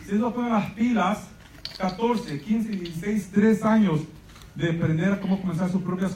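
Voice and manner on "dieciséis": ordinary delivery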